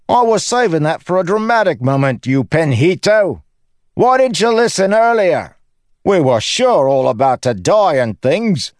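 high, loud comic outrage; indignant tirade. Acted. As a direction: berate the heavens in a cocky joker's voice. Lopen scolds the sky.

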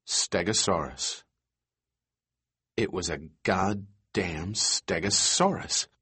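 low, hushed disbelief; stunned hush. A narrator's reading. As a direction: breathe it out in awe. Stunned realisation of the dinosaur.

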